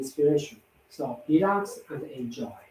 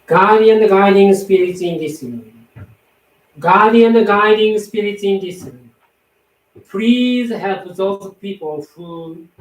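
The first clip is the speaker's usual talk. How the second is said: loud, raised, fervent calling out; loud invocation; 4 semitones higher, 8 dB louder than usual. Acted. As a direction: call the spirits into the room, loud and fervent. Invokes spirits in the room.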